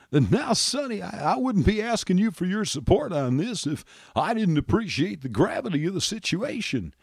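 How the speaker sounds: soft earnest politician on the phone; Bill Clinton. Acted. President on phone to congressman.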